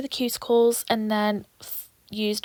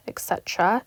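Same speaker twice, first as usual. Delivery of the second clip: ordinary talk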